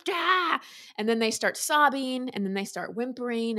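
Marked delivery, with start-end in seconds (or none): 0.1-0.6 short high mock yell of a child; high-pitched mock tantrum shout; child's tantrum cry. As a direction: imitate a kid's angry outburst. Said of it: "and they're".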